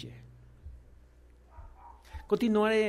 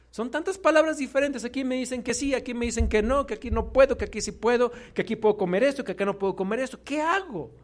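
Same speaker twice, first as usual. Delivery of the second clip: confused seeker; 7 semitones higher, faster than usual. Retold or imitated. Preacher voicing a confused believer, anxious and bewildered. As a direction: voice a lost person torn between teachings, rising in panic.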